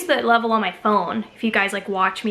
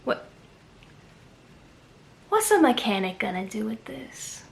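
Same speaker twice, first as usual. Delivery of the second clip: whispered aside; 4 dB quieter than usual. Real, not acted. Whispered joke about mirror.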